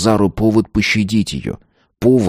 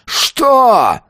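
much higher, slow raging scream; 12 semitones higher, much slower than usual. A narrator's reading. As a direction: scream until it breaks. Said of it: "заорал он".

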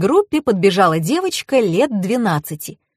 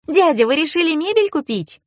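put-on high-pitched child addressing an adult; young girl; 9 semitones higher than usual. A narrator's reading. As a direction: ask in a little girl's high voice. Narrator voices the girl.

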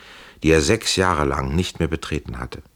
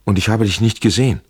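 louder, fast, urgent cry; called out; 4 semitones higher, 5 dB louder, faster than usual. A narrator's reading. Amalie calls to Trenck as soldiers come, loud and fast. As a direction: call it out before they take her away. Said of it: "rief sie".

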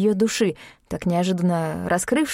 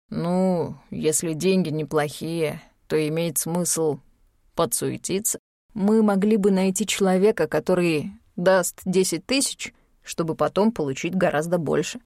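laid-back schemer Sashka. Acted. Sashka suggests finding someone with money, laid-back. Voices Sashka proposing a deal.

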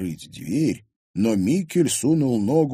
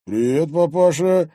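raised, drawn-out cheeky greeting; puzzled young tough; 7 semitones higher, 7 dB louder than usual. Acted. Thug surprised by the girl's father, puzzled. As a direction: greet the older man slowly, puzzled. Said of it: "недоуменно буркнул рыжий".

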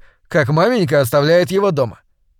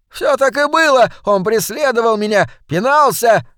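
much higher, whiny tattling; telling tales; 8 semitones higher, 3 dB louder than usual. A narrator's reading. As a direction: snitch to the policeman like a child. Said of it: "наябедничал толстяк".

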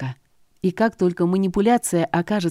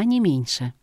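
ordinary delivery, nothing marked

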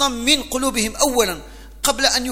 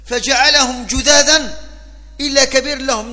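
emphatic; 2 semitones higher, 6 dB louder than usual. Acted. Emphatic quotation of Quran.

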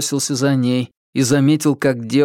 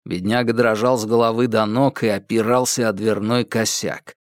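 ordinary delivery, nothing marked

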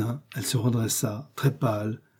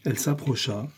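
ordinary delivery

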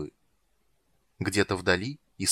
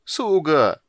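higher, louder, with a wince of distaste; scornful; 7 semitones higher, 6 dB louder than usual. A narrator's reading. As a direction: throw the word back with contempt. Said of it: "скривился морской король". (презрительно скривившись)

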